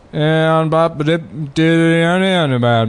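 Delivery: gibberish in a presidential rhythm; mock speech melody without words; Obama cadence. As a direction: scat nonsense syllables like a halting politician. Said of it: "has the cadence".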